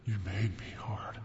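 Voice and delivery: breathless whisper. A narrator's reading. Lover confessing in a whisper.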